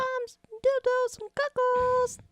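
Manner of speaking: high mock voice. Mock selling seafood.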